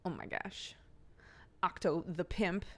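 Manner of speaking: ordinary delivery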